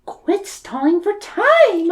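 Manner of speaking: put-on very high girlish Mina voice. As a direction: squeak it out like a young girl.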